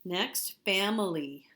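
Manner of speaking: ordinary talk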